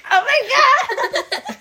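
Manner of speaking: mock shock. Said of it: "you're like".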